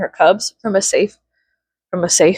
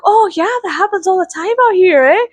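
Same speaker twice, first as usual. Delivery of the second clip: high, bright-eyed Canadian moose voice, much higher; Canadian accent; 11 semitones higher than usual. Retold or imitated. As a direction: play a friendly cartoon Canadian with a Canadian accent. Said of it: "they sounded like the mooses, the moose from Brother Bear".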